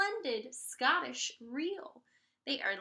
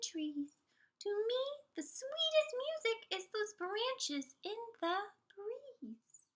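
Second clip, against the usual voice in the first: kind cricket voice; 7 semitones higher than usual. Acted. Cricket advises Gerald.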